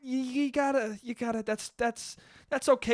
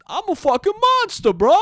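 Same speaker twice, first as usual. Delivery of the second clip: much higher, louder dumb monster bro; dopey monster voice; 13 semitones higher, 9 dB louder than usual. Retold or imitated. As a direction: brag like a dim monster. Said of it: "That's how he talked in the fucking movie".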